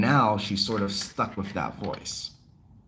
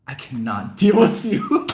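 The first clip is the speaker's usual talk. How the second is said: louder, laughing through the words; laughing exasperation; 2 semitones higher, 7 dB louder than usual. Retold or imitated. Retelling the reaction to the coworker, laughing and louder. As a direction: let a laugh break into the line.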